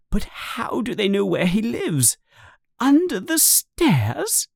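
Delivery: high, anxious woman's voice; alarmed questioning. A narrator's reading. Aunt Petunia asks Vernon how they know, alarmed questioning. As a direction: ask it high, slowing at the end.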